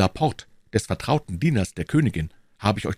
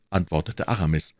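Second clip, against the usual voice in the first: ordinary delivery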